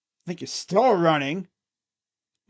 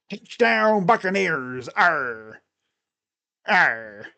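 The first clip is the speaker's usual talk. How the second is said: pirate stadium announcer; much slower than usual. Pretend. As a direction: announce it like a badly faked pirate. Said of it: "Imagine the P A announcer for the Buccaneers just talks in pirate voice".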